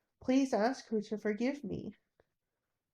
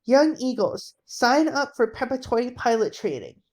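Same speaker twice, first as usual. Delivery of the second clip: recruiting call; 3 semitones higher, 7 dB louder than usual. Acted. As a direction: proclaim to the crowd.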